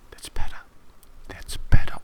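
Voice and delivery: loud, hissing villain whisper; creepy villain whisper. Retold or imitated. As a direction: whisper with menace, savouring it. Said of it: "unbelievably creepy".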